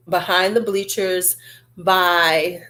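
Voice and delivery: normal talking voice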